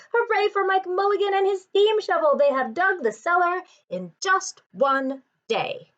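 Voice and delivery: crowd cheering. Acted. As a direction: cheer for the steam shovel, then ease off. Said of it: "shouted the people".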